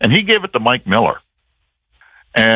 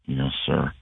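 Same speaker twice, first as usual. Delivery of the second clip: a low, deflated yes sir, much softer and lower; very low, meek; 9 semitones lower, 11 dB quieter than usual. Retold or imitated. Players answering an angry coach at practice, very low and meek. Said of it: "Like, very low".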